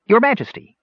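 high, polite gnome address; smooth it over like a diplomat; diplomatic. A narrator's reading. Gnome to the mad king, diplomatically polite. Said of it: "said Cider diplomatically".

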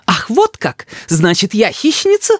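high, wounded indignation; high-pitched affronted challenge; offended mother. A narrator's reading.

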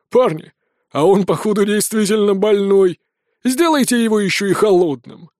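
rough, put-on brigand voice; grim boss addressing his men; menacing bandit chief. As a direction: order the gang to finish him.

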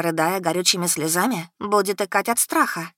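ordinary delivery, nothing marked

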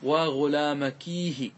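"وغلامكيه" slow, long kee closed by hi; ya with pausal ha. Shown as a demonstration. Teacher adding the pausal ha, drawn-out ending on "وغلامكيه". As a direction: stretch "وغلامكيه" through the ee into a final h. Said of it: "ثم أضفنا بعدها هاء السكت".

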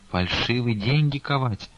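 usual speaking voice

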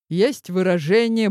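ordinary delivery

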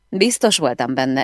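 ordinary delivery

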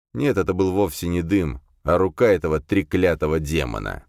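ordinary delivery, nothing marked

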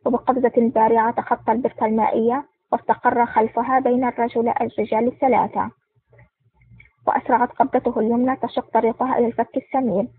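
plain reading voice

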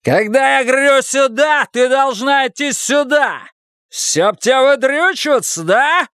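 high, raging tirade; furious yelling. A narrator's reading. Husband demanding wife come to him, shouting furiously. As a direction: roar the orders at her. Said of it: "заорал он".

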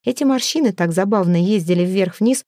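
ordinary delivery, nothing marked

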